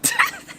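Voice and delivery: sudden squeaky giggle that shoots up; burst of high laughter. Real, not acted. Host cracking up at the weasel, high giggle. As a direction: crack up at the joke. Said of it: "is hilarious".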